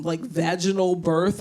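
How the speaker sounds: normal talking voice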